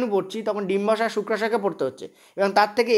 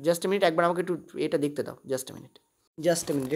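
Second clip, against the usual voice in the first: muttered aside; 6 semitones lower, 7 dB quieter than usual. Real, not acted. Teacher searching printout mid-lesson.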